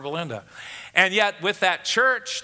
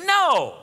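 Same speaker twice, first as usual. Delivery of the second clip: a high, drawn-out "no" falling hard; emphatic; 8 semitones higher, 4 dB louder than usual. Retold or imitated. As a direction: punch out one long, emphatic "no" from high up. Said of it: "the Greek is emphatic".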